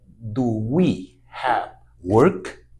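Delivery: slow, careful English model sentence; slow teaching demo. Shown as a demonstration. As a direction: spell out do we have work slowly for learners. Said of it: "Entonces, mire".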